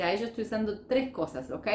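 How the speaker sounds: ordinary delivery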